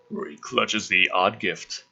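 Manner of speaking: ordinary talk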